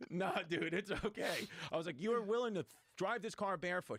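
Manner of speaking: can't believe he'd drive barefoot; incredulous. Retold or imitated. Passenger to driver offering to drive barefoot, amazed.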